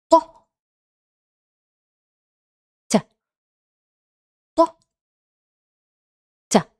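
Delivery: heavy ط set against a light ت; contrast ط and ت. Shown as a demonstration. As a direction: alternate ط and ت, ط heavier. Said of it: "I will pronounce now the difference".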